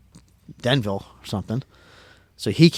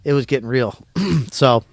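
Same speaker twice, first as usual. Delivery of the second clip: usual speaking voice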